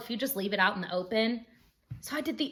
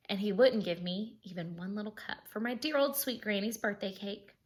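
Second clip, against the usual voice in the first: ordinary delivery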